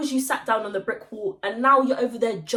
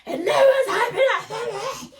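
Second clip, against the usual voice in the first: high, mocking laughing voice; mock her snotty reply; imitating the girl; 11 semitones higher than usual. Girl answering back, mocking high impression. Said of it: "She's like".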